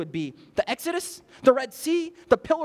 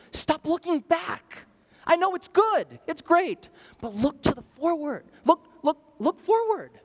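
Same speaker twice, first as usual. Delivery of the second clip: raised, high-pitched preacher's urging; urgent exhortation; 7 semitones higher, 4 dB louder than usual. Acted. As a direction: call out God's command, high and forceful. Preacher voices God urging listeners forward.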